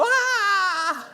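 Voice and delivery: high, drawn-out comic scream; hammed-up yelp of panic; mock scream. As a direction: scream in exaggerated alarm. Startled scream at officer, retold.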